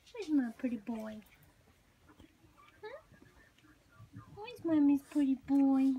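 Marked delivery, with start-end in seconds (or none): none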